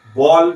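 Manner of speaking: बॉल with a rounded open aw vowel; correct ball. Shown as a demonstration. Teacher models the right English word, clear open vowel. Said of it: "ये सही उच्चारण होगा बॉल".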